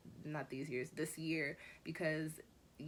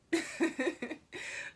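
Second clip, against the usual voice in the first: light laugh; 11 semitones higher, 4 dB louder than usual. Real, not acted. Laughs at own joke.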